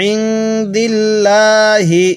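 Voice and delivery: ইনদিল্লাহি drawn slowly as in Quran reading; slow recitation. Shown as a demonstration. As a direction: recite it slowly with heavy লাম. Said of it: "এরকমভাবে শিখব". (ধীর তিলাওয়াত)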